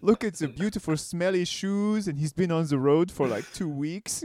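hammed-up French accent, swooning; fancy French waiter. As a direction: gush like a snooty French waiter. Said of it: "like a fancy French, like, waiter's like".

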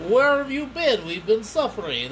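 lightly hammed-up reproach, pitched high at the start; imitated film character.

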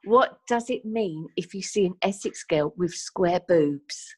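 normal talking voice

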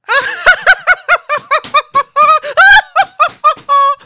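very high, loud fake cackle; a shrill fake party laugh; Petunia's shrill fake laugh from downstairs. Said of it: "Petunias schrilles Fake-Lachen".

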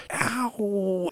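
put-on sharp high "Ow!" of a kid; child's yelp of pain. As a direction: cry out like a little girl scraping her knee. Daughter falls, hurts knee.

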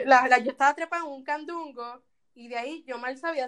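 ordinary delivery

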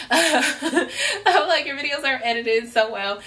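laughing through the compliment. Retold or imitated. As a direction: gush it while laughing.

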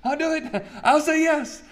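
a keen player begging the coach; eager Isaiah. Retold or imitated. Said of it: "And Isaiah goes".

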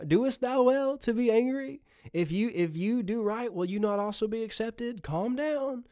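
high, light voice of God soothing; playful hint of God saying calm down; God gently calming. God to an angry believer, high and soothing. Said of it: "God's like".

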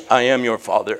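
low, booming movie-villain rumble; a deep voice rumbling through the glass; deep Darth Vader voice. Retold or imitated. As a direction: drop way down and boom like a bass woofer. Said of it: "the man who swallowed a, a bass woofer".

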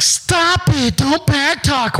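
very high, slow scolding mom; high drawn-out mom scold; shrill mock mom. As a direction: pitch way up like a shrill scolding mother. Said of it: "moms will be like".

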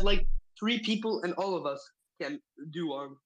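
ordinary delivery

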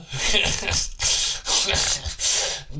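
breathy wheezing laugh; real laugh, mostly breath. Real, not acted. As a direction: laugh helplessly at your own joke. Laughs after his own "Duh.

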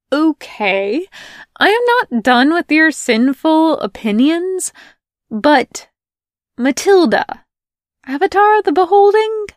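high, loud, rushing to new point; can't wait to start the next fight; excited. Acted. Melanie excited new topic.

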